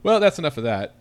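a touch of exasperation, giving up; mild frustration. Retold or imitated. Host recalls giving up on the recording, mildly frustrated. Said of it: "I was so frustrated. I was like".

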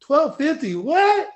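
high-pitched, loud incredulous echo; loud disbelief. Retold or imitated. Lifter echoing the misloaded weight, loudly incredulous. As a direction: repeat it back, voice leaping up in shock. Acting his confusion at the weight.

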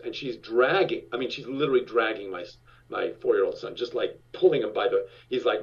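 ordinary talk

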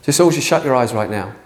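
ordinary talk, nothing marked

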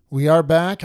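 loud, high call. Real, not acted. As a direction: shout it out to the room. Shouting show return.

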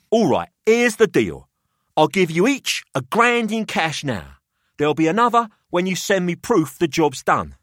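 put-on a wry businessman weighing up the boys; Pelham's voice.

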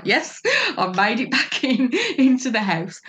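gloating through laughter; smug triumph. Retold or imitated. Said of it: "I must have looked so smug".

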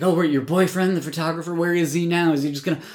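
mock his suspicious sneer; angry sarcasm. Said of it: "he's angry".

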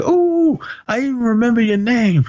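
mock recognition. Acting out teasing recognition at dinner.

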